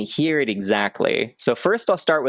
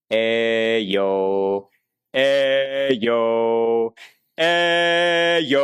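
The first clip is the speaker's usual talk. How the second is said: open-throated build; much slower than usual. Shown as a demonstration. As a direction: project without strain, throat wide open. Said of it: "but not getting constricted".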